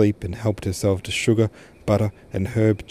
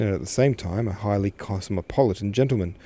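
usual speaking voice

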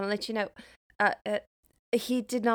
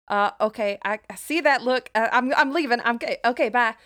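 a louder, hurried nervous exit under a glare; flustered retreat; 4 semitones higher, 10 dB louder, faster than usual. Acted. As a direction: back away fast, babbling apologies. June flees Ganbald's stare.

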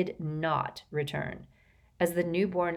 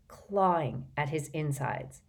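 ordinary delivery

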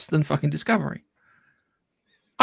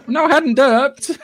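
high, loud, laughing protest; laughing denial; 9 semitones higher, 6 dB louder, slower than usual. Real, not acted. Laughing off friends' teasing, high and loud. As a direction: laugh it off, drawn out.

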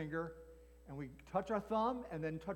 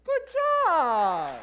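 loud high drawn-out praise; excited praise; 8 semitones higher, 12 dB louder than usual. Real, not acted. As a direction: cheer them on. Praising kids.

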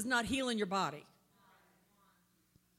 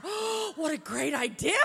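high, loud laughing "Oh"; mock delighted; 6 semitones higher, 9 dB louder than usual. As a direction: play up fake enthusiasm. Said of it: "And I said".

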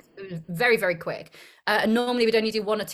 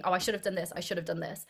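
lower, softer, under the breath; self-critical muttering; 4 semitones lower, 9 dB quieter, faster than usual. Retold or imitated. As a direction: murmur the self-criticism inwardly. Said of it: "watching it thinking".

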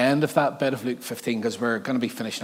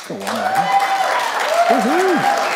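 loud, high, drawn-out cheer; high cheering whoop for Maggie; 6 dB louder, much slower than usual. Real, not acted. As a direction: whoop her on like a fan. Cheering on the worship leader.

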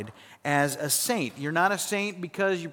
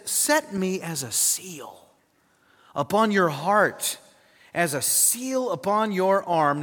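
each phrase of "Set me as a seal upon your arm" drawn out slowly and solemnly; preacher reads the verse to the congregation, slow and solemn; slow scripture reading; slower than usual. Shown as a demonstration. Preacher reading Song of Solomon aloud, slow and reverent. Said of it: "it says".

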